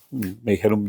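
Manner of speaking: plain reading voice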